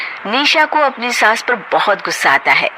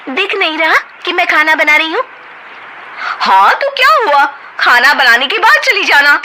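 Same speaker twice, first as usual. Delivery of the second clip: heated quarrel; 9 semitones higher than usual. Acted. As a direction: snap back and forth. Sisters-in-law quarrel.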